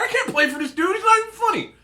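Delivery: high, loud laughing protest; squealing in mock outrage; high laughing complaint; incredulous whine. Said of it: "I'm like".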